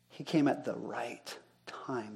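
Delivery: slow, hushed whisper with the voice dropped away; dramatic whisper. Acted. Preacher answering his own question, slow hushed whisper. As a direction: let it fall to a slow whispered reveal.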